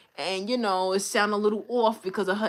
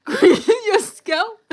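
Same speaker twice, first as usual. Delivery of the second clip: laughing; 12 semitones higher, 9 dB louder, slower than usual. Real, not acted. Laughing while getting a massage.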